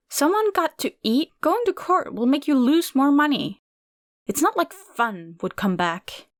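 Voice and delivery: aunt boasting and sneering; angry rant. A narrator's reading. Aunt defends taking money.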